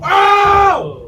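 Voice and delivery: loud. Real, not acted. Shouting in pain and excitement.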